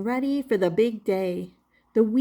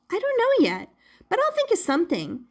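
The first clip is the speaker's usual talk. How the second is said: much higher, quick excited puppet; excited Punchinello; 10 semitones higher, faster than usual. Acted. Puppet unsure but eager to surprise his maker. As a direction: rush it out, bubbling.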